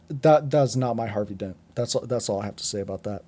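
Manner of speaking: normal talking voice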